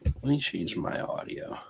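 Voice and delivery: whispered aside to himself. Real, not acted. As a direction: breathe it off mic, unvoiced. Muttering aside during audio trouble.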